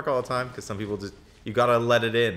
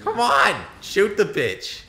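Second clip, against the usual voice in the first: much higher, loud urgent shout; frustrated yell; 10 semitones higher, 7 dB louder, much slower than usual. Real, not acted. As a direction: yell at them to shoot. Tense firefight, urging teammate.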